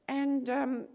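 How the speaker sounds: ordinary talk, nothing marked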